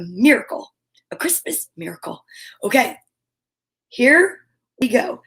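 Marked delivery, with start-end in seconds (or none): 1.1-2.2 hushed aside, breathy and soft; whisper. Real, not acted. Host whispering a joke aside to viewers, hushed. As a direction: whisper it like a little secret. Joking about learning German.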